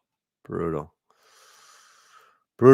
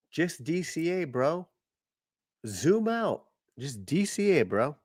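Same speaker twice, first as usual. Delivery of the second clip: mocking bro voice; 6 semitones higher, 5 dB quieter than usual. Mocking advice to dip buyers.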